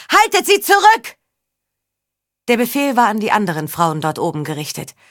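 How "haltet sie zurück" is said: very high, loud urgent shout; bellowed command. A narrator's reading. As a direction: shout an emergency order to the others. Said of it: "brüllte sie". (gellend gebrüllter Befehl)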